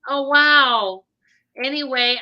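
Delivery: normal talking voice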